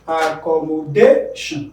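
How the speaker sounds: Accommodation drawn out, voice rising on the DA syllable; ac-com-mo-DA-tion, spaced out with DA stressed. Shown as a demonstration. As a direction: lift and punch DA in Accommodation. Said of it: "Meaning that they is stressed".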